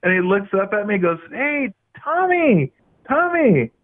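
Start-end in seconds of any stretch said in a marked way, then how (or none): 1.3-3.7 high, slow, drawn-out mock greeting, stretching the name; smug old coach greeting. Old coach greeting his former player in Vegas, high and drawn out. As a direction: play the smug coach savoring the moment.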